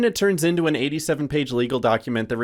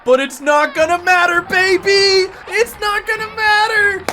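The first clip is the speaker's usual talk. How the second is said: high, loud, screaming sportscaster call; play-by-play call; 8 dB louder, slower than usual. Acted. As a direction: scream the grand slam like a broadcaster. Calls a grand slam.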